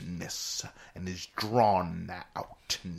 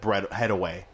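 ordinary talk, nothing marked

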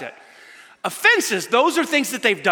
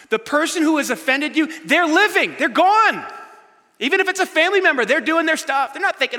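preaching at full force; 9 semitones higher, 6 dB louder than usual. Acted. Passionate preaching.

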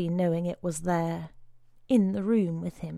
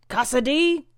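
high, loud roar of a name; 7 semitones higher, 7 dB louder than usual. A narrator's reading. Brother Timothy roaring at the boy, loud roar. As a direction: bellow the name across the room. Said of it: "roared the man behind him".

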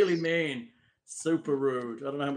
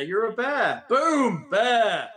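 loud, high triumphant reveal; excited announcement; 7 semitones higher, 6 dB louder, much slower than usual. Acted. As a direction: announce it with a flourish. GM announces bear transformation.

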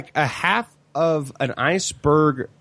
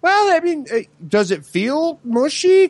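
high, careful coaxing voice; mock-gentle high inquiry; gentle probing question; 12 semitones higher, slower than usual. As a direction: ask it sweetly, high up, like testing a patient. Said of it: "And I was like".

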